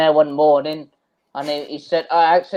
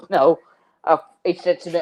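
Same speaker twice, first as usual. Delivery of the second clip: ordinary talk, nothing marked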